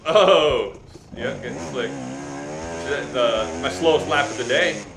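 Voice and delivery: ordinary talk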